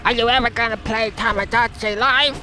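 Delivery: put-on squeaky South Park Canadian accent. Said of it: "talking like, um, a South Park Canadian".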